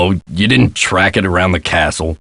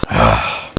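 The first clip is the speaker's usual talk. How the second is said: low, soft weary sigh; exasperated sigh; 11 semitones lower, 4 dB quieter than usual. Acted. Man faced with a mess to clean, exasperated sigh. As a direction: let out a fed-up breath.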